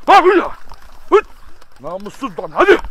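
horozu kovarak tiz ve yüksek sesle bağır; öfkeyle haykır, hayvanı uzaklaştırmak için; gerçek kızgın bağırış. Real, not acted. Horozu tekmeyle kovuyor. (saldıran horoza öfkeli bağırış)

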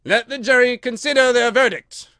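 high, loud courtroom order; booming royal command. A narrator's reading. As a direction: proclaim it to the whole court.

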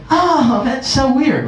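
put-on light childish squeal; mock kid voice. Kid self reacting, pitched up.

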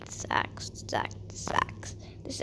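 very soft, voiceless repetition; whispered muttering. Real, not acted. Player focusing on the axe, whispered muttering. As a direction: whisper it to yourself.

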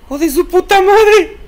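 high, loud shocked swearing; startled cursing. Real, not acted. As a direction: curse out the fright. Cursing after jump scare.

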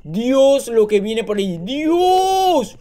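astonished cry rising to a high wail; real shocked outburst; alarmed amazement. Real, not acted. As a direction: exclaim in alarm, last word drawn out high. Alarmed at what is coming.

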